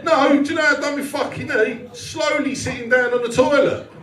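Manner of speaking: ordinary delivery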